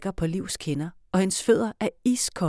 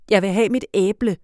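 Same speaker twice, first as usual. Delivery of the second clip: louder, demanding shout; 3 semitones higher, 8 dB louder than usual. Acted. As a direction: Felix demanding his apple, shouting. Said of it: "råber Felix".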